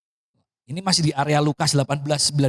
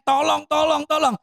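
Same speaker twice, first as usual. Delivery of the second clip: high, repetitive mock pleading; retold whining plea; 10 semitones higher than usual. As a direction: imitate a widow pestering for help. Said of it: "ngerengek-ngerengek". (menirukan rengekan minta tolong)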